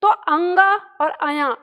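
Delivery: slow, stretched अंगा and अया; stretched mock pronunciation of अंगा and अया; drawn-out wrong sounds. Shown as a demonstration. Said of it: "इतना लंबा चौड़ा".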